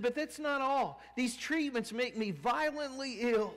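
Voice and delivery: high, loud bitter patient voice; complaining woman. Retold or imitated. Cancer patient complaining to Miss Miller, high and loud. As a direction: push the grievance hard.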